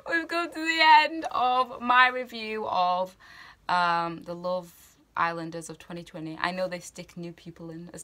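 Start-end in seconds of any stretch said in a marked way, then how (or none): 0.1-3.2 high, loud, drawn-out fake wail; hammed-up sad sign-off; mock tearful. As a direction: put on a tearful farewell, slowly. Mock-emotional sign-off after laughing.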